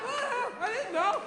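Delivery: high, fake innocent protest; playing dumb. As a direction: act clueless for security. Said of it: "you just play dumb".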